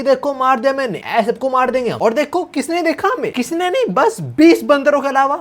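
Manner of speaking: high, lightly mocking excited voice; retold glee. Narrator retells the son bragging about killing a monkey, high mock glee. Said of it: "बड़ा खुश हो रहा है".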